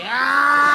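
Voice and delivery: high mock battle yell; attacker's yell. Imitating the attacker jumping at him, high yell. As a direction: let out a lunging shriek. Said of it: "He was like".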